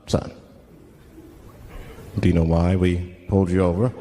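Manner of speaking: low-pitched cop at a traffic stop; police officer. Acted. Officer questioning pulled-over driver, low cop voice. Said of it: "the police pull me over".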